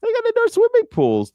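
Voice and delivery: put-on very high, squealing kid-like gush; mock excitement. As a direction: gush like an overexcited camper. Mocking camp ad, excited.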